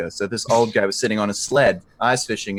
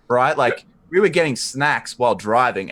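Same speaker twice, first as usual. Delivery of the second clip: normal talking voice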